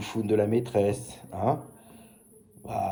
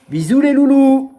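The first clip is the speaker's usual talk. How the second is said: high, loud sing-song goodbye; cheery sign-off; 9 semitones higher, 12 dB louder, slower than usual. Real, not acted. Host signing off to his loulous, loud high sing-song farewell. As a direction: blow kisses with the voice, up high.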